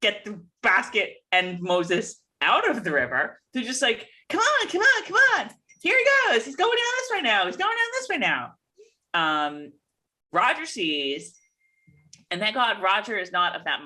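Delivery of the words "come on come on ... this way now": put-on high-pitched boys' chatter; boys calling out. Boys trailing the floating basket, high-pitched calling out. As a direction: call along after it in a high voice.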